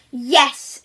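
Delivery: loud eager shouted yes. Acted. Wolf shouting yes to the creature, loud. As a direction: shout it out at once. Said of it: "shouts the wolf".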